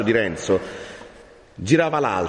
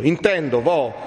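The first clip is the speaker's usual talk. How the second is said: high, urgent rush of resolve; distraught young man; 6 semitones higher than usual. A narrator's reading. Renzo vows to search the whole lazzaretto, distraught. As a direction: build higher through the list. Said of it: "cambiandosi tutto in viso".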